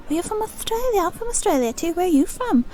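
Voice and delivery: high, exaggerated Aussie lilt; hammed-up, high-pitched Australian; Australian accent. Shown as a demonstration. Playing one Australian meeting another, high put-on Australian accent. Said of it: "I can't do my Australian accent".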